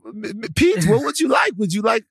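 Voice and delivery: put-on high-pitched comic Netherlands accent; mock Netherlands accent. Joking about being greeted in the Netherlands, mock Netherlands accent. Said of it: "doing the Netherlands voice".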